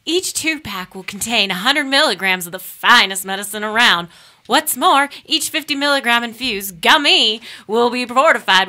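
upbeat announcer read for the sponsor; radio ad read. Acted. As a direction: sell the product like a radio spot. Reads a sponsor ad.

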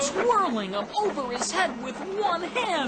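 high, rising, amazed broadcast call; excited announcer. Acted. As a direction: sell the miracle to the audience. Reporter announces the amazing feat live.

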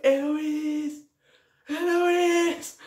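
high, long searching cry; acted calling out. Searching for a friend after the blast, acted calling out. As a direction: call across a crowd, hammed up.

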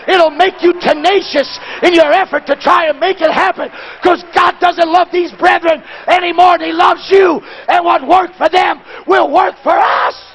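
high, loud preacher's climax; full-force preaching. Acted. Preacher urging them to hold on, full-force preaching. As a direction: drive it home to the congregation.

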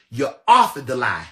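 shouting "You authored the lie" in rage; angry shout. Real, not acted. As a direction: yell the accusation at him. Repeating accusation, building to yell.

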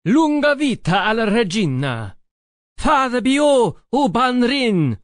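loud high toast roared by a crowd; cheering yell. Acted. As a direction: raise the mug and roar the toast, high and loud. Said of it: "my men yelled back".

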